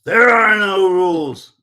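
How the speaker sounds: loud, high drawn-out bellow; intimidating shout. Retold or imitated. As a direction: shout it slowly to intimidate a challenger. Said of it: "he's trying to intimidate me".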